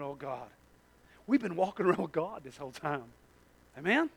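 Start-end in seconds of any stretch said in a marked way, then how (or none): 0.0-3.0 awed hush. Acted. Peter's awed realisation.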